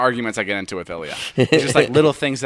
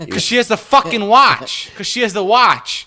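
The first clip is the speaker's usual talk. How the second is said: retold outburst, higher and louder; exasperated yell; 9 semitones higher, 6 dB louder than usual. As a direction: yell it like the answer is obvious. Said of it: "I got so mad at him".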